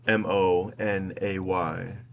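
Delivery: M O N A Y spelled slowly letter by letter. Shown as a demonstration. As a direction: read M O N A Y as separate letters, slowly. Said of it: "If Monet's name is pronounced".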